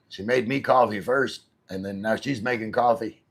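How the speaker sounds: ordinary talk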